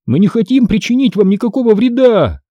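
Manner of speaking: high, sustained appeal; a narrator's restrained shout; mild cry. A narrator's reading. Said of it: "закричал я".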